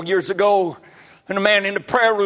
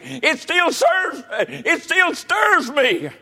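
raised voice building to a high cry; stirred-up preaching; 6 semitones higher than usual. Real, not acted. Preacher recalling getting saved, voice climbing to a fervent cry. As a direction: preach it with mounting fervor.

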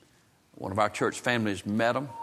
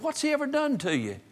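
very high, drawn-up questioning voice; incredulous challenge. Retold or imitated. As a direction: challenge him in high-pitched disbelief. Preacher challenges the boy's confession.

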